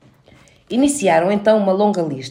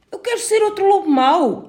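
higher, insistent little wolf; insisting; 12 semitones higher, 4 dB louder than usual. A narrator's reading. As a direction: push your wish as the little wolf. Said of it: "insistiu o lobo".

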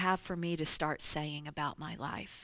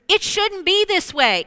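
loud protest; 11 semitones higher, 13 dB louder than usual. Retold or imitated. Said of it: "In other words".

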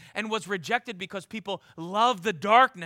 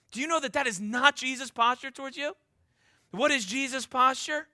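usual speaking voice

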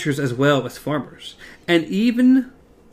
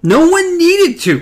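much higher, louder, slow shout; 8 dB louder, slower than usual. Acted. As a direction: yell it back at him. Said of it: "Cuthbert shouted".

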